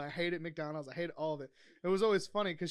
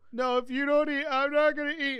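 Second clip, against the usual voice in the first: higher, louder imitation of his girlfriend; mimicking girlfriend; 9 semitones higher, 8 dB louder than usual. Man imitating his pregnant girlfriend refusing to eat, higher and louder. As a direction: insist like a stubborn pregnant girlfriend. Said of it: "she'd be like".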